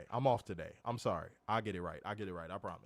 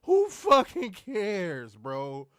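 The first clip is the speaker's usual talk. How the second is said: slow, loud, each word hit; exasperated rant; 7 semitones higher, 5 dB louder, much slower than usual. Real, not acted. Host ranting about the belt, slow exasperated emphasis. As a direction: drag out every word in disbelief.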